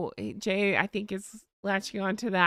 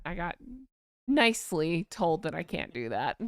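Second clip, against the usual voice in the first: ordinary delivery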